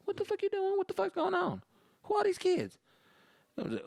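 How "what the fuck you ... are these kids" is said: very high-pitched mock-outraged mom; cussing mom. Mom cusses at her son in front of friends, high and indignant. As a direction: demand answers in a high mom voice. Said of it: "this mom was not afraid to cuss".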